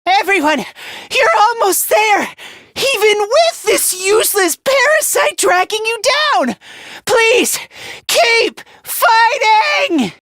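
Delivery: high, straining shout, building; impassioned rallying cry. Acted. Begging everyone to keep fighting, impassioned cry. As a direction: cry out with everything, stretching the last words. Crying out encouragement in battle.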